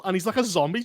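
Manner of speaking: ordinary delivery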